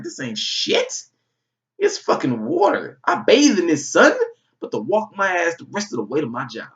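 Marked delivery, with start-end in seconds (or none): 0.0-4.1 high, slow, swaggering bravado voice; cocky tough guy. Retold or imitated. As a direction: brag to the storm in a put-on tough voice, building louder. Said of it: "I was like".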